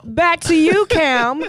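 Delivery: high, loud on-air handoff voice; hammed-up broadcaster tossing back to the studio; mock TV presenter.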